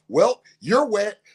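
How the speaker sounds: put-on a child's teasing retort; kid taunting. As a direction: tease him gleefully. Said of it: "She's like".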